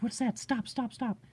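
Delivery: high-pitched, urgent passenger; retold alarm. Passenger spotting something from the truck, retold alarm. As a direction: imitate a startled friend halting the driver.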